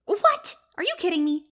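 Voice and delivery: sudden high startled what; a sharp shocked squeak; surprised. Acted. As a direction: jolt up in shock. Shocked at mob boss news.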